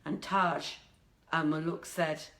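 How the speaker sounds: ordinary delivery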